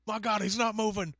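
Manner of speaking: high-pitched mock-alarmed commentator; Jim Ross. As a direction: play the stricken ringside announcer. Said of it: "Jim Ross impression".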